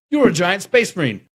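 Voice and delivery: loud, high mock director; hammed-up bossy director. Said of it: "the direction was like".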